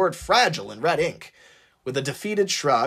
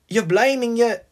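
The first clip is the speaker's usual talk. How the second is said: musing; 8 semitones higher than usual. Acted. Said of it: "Maxine mused".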